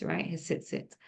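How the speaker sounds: normal talking voice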